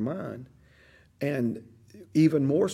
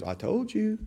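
higher, taunting "I told you"; taunting devil; 10 semitones higher, 3 dB quieter, slower than usual. Acted. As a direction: gloat like a devil on the shoulder. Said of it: "And the devil goes".

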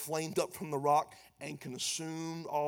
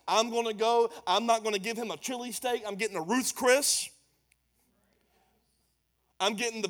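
loud, high, driving preacher's delivery; full-force preaching; 7 semitones higher, 11 dB louder than usual. Acted. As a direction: preach it hard to the congregation.

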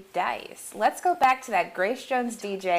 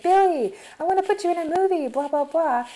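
high-pitched, hurried producer pitch; mock an eager producer, pitched up high; eager movie producer; 8 semitones higher than usual.

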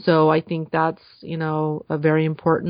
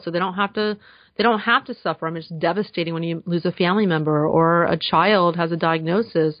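ordinary talk, nothing marked

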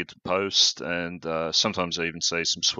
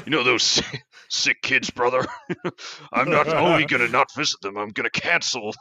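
loud, high, gruff heel wrestler rant; heel wrestler promo; 7 semitones higher, 5 dB louder than usual. Pretend. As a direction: shout it like a villain wrestler. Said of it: "As part of his heel turn".

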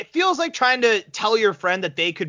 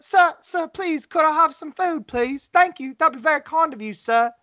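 higher, prim pleading little voice; fancy British dog; 8 semitones higher than usual. Pretend. Bell-trained dog begging its owner, prim high plea. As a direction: beg sweetly like a polite little dog. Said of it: "it's like".

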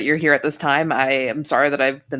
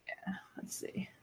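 whisper; 4 semitones higher, 25 dB quieter than usual. Real, not acted. Player waiting on the dice roll.

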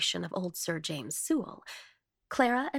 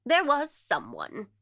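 slow, sly answer with raised pitch; sly and deliberate, a little loud; cunning; 6 semitones higher, 5 dB louder, slower than usual. A narrator's reading. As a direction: drop a slow, cunning hint. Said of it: "answered Miss Le Fane cunningly".

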